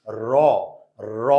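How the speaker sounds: র prononcé « rô », voyelle ouverte tenue; র avec voyelle ô. Shown as a demonstration. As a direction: dire র isolément, en faisant entendre le « ô ». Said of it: "আমরা যদি উচ্চারণ করি".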